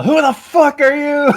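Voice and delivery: high, drawn-out challenge yell; high, stretched demanding shout. Acted. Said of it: "raise my crossbow and yell".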